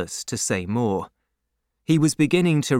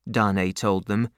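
ordinary delivery

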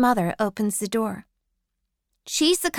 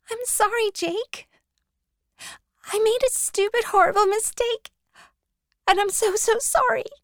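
high, teary pleading; tearful apology; 10 semitones higher than usual. Acted. Addie confessing to Jake, tearfully pitched high. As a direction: near tears, voice pitched high and cracking. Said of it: "I'm crying now".

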